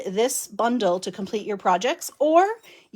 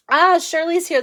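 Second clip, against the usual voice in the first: usual speaking voice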